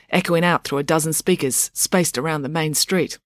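ordinary delivery

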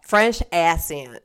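slow FRENCH ACCENT, ACCENT as "ass-ee-ent". Real, not acted. Co-host retrying the word, ACCENT slowly as "ass-ee-ent". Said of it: "I usually say it like that for fun".

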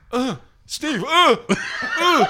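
high, breathy mock moans; mock moaning. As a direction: moan his name, hammed up. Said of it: "laying there at three o'clock going".

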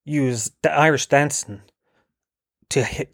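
usual speaking voice

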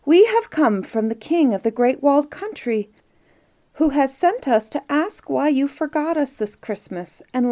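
earnest envoy calling up to someone; crying out. A narrator's reading. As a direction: deliver the prince's plea out loud. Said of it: "cried the prince who was in charge".

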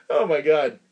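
ordinary delivery

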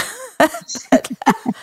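a high, bright burst of laughter; sudden high laugh; real laugh. Real, not acted. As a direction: break into a real laugh at the joke. Laughing at her own covid joke.

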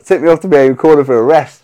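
normal talking voice

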